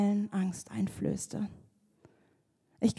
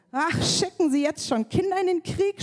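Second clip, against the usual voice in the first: mocking giant; 8 semitones higher than usual. A narrator's reading. Goliath mocks young David, higher sneering taunt. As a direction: scoff at the boy soldier. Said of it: "spottete er".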